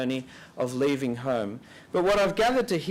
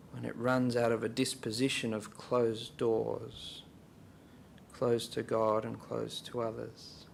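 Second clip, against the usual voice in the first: guided reflection; 3 semitones lower than usual. Real, not acted. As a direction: pose it gently for silent prayer, softening as you go. Leads silent reflection with questions.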